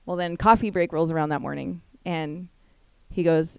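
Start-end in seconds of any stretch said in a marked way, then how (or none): none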